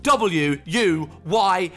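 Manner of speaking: punchy announcer-style plug; podcast promo read. Real, not acted. Host telling listeners about Patreon, emphatic promo read. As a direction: sell the full episode, hitting each key word. Reads promo for full episode.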